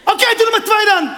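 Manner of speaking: high, loud exasperated shout; mock shout. Seller shouting at the buyer, loud and high. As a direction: bark it out like a fed-up seller. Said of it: "Zo zei ik het niet".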